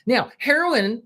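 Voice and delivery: usual speaking voice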